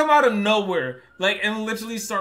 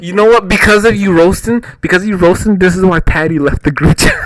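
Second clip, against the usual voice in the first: much louder laughing accusation; 14 dB louder than usual. Real, not acted. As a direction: blame him at full volume. Heated accusation in banter.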